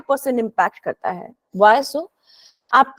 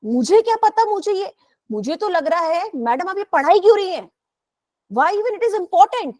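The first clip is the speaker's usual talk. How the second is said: higher-pitched student complaint; objecting student; 6 semitones higher than usual. Acted. Student asking madam why she is teaching this, objecting. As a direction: voice a student questioning the point, pitched up. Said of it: "या फिर आप बोले".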